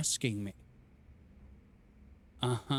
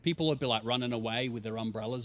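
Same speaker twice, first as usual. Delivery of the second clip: ordinary talk, nothing marked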